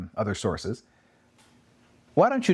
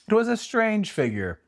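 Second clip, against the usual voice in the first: raised, theatrical reading of Dickens; dramatic reading; 10 semitones higher than usual. Acted. Host reads Dickens's ghost description to Abby, theatrical. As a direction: read it like a stagey storyteller.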